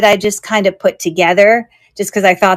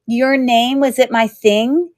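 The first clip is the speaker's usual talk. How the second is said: usual speaking voice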